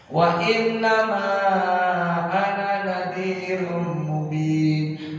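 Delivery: slow, drawn-out chanted recitation; Quran recitation. Acted. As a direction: intone the verse slowly, like tilawah. Chanted Quran recitation, short ana.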